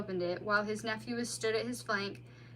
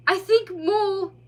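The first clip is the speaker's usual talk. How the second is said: high, slow, dreamy voice; drowsy otter; 9 semitones higher, 11 dB louder than usual. A narrator's reading. Tipsy otter answering the mole, dreamy. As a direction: drift off mid-sentence.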